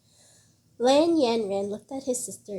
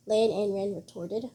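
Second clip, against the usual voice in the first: usual speaking voice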